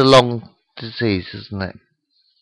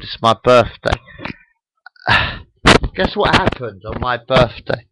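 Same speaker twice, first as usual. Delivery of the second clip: ordinary delivery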